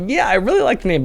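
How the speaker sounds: a hint of Rob gushing over the name; mock enthusiastic Rob. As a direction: echo the praise in a teasing, lifted voice. Rob's new opinion, mock-quoted.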